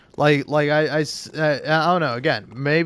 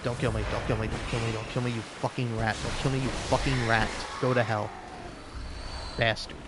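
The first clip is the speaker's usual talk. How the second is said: cursing the enemy under the breath, softer; hissed pleading and swearing at the screen; softer muttered cursing; 3 semitones lower, 8 dB quieter, faster than usual. Real, not acted. Gamer curses the enemy killing him, softer muttered swearing. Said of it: "I'm so mad".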